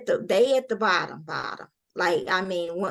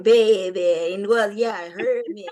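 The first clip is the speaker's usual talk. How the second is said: put-on Mississippi country accent; 5 dB louder than usual. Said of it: "and then to go to speaking".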